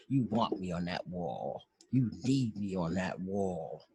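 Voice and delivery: low, slow colonel's growl of "You want me on that wall"; menacing colonel. Retold or imitated. The colonel tells the court he is needed on the wall, low and slow. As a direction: lean in low and slow, leaving every word to sink in. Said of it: "he was like".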